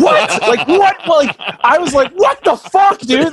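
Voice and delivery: high, loud indignant "what the fuck"; outraged disbelief. Retold or imitated. Friend raging at a Biden ad, high loud outrage. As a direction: explode in disbelief, pitch shooting up. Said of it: "I was so pissed".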